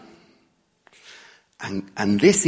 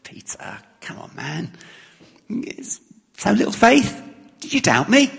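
soft, light and higher, friendly nudge; a softer, higher, affectionate reading; gentle teasing; 8 semitones higher, 3 dB quieter than usual. Shown as a demonstration. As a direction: rib a mate kindly. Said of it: "Or do we read it as".

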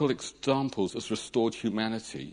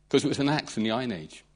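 usual speaking voice

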